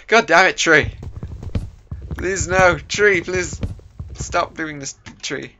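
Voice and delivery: pleading with the tree; exasperated. Real, not acted. Frustrated at a tree.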